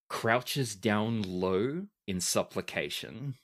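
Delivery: usual speaking voice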